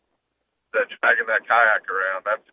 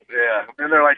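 ordinary talk, nothing marked

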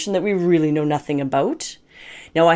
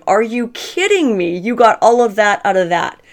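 incredulous laughing disbelief; 4 semitones higher, 5 dB louder than usual. Retold or imitated. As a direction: retell your own disbelief at his story, laughing. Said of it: "bent over, going".

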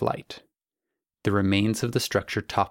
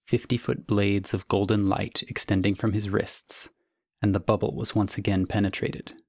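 ordinary delivery

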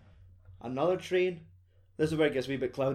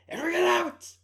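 shrieking high mock yell, much louder; violent outburst; 6 dB louder than usual. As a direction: blurt it out in a sudden high shriek. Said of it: "there's violent outbursts".

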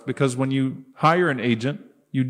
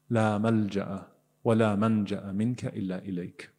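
recited du'a; 4 semitones lower, 5 dB quieter, much faster than usual. Real, not acted.

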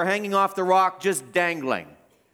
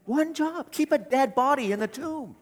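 mocking emphasis; 8 semitones higher than usual. Preacher mocks guards' single task.

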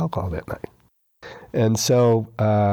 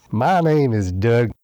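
slow, louder imitation of a thick West Texas twang; Doug's West Texas twang; 5 dB louder, slower than usual. A man imitates his sponsor Doug introducing himself in a thick West Texas twang. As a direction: drag out every word the way a West Texas drawl would. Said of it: "Doug had a really thick West Texas twang".

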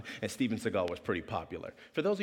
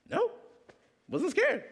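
put-on very high little-kid voice; exaggerated childish insistence; child denying fear; slower than usual. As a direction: squeak out a mock-brave denial. Boy answering his dad again.

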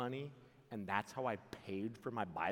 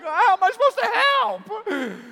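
mock helpless plea, much higher and louder; a hammed-up helpless question; exasperated guy; 19 dB louder than usual. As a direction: throw up your hands as a baffled guy. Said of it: "We're like".

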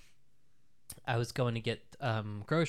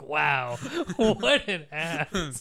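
higher, louder, breaking into laughter; laughing self-mockery; 6 semitones higher, 9 dB louder, much slower than usual. Guest retelling scorn at own coffee snobbery, laughing and loud. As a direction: drag out the self-insult through a laugh.

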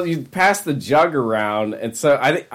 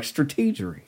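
George W. Bush impression; 2 semitones higher, 8 dB quieter than usual. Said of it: "doing a George Bush impersonation".

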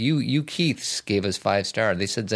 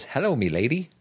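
put-on Irish accent; 4 semitones higher than usual. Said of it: "That's Irish".

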